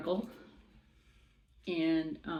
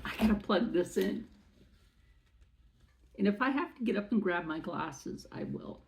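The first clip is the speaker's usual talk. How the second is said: usual speaking voice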